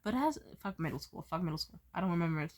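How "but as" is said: ordinary delivery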